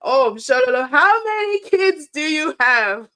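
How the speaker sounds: half-laughing, impressed and teasing; a delighted mock gasp at a stern mother; laughing disbelief. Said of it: "And I was like".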